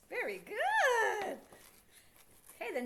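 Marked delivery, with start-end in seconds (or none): 0.1-1.4 drawn-out excited praise. Real, not acted. Praising child's answer.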